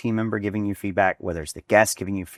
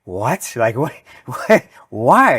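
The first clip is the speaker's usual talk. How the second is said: rising, louder mock disbelief with a laugh; stunned retold "what? why?" climbing higher and louder; shocked disbelief; 5 semitones higher, 8 dB louder, slower than usual. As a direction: replay the moment your jaw dropped. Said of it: "and I was like".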